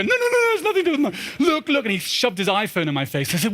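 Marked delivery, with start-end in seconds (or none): 0.0-1.8 very high, urgent calling of dad; excited teen. Retold or imitated. Son running upstairs to tell his father big news, very high and excited. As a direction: burst in breathless with news, pitch way up.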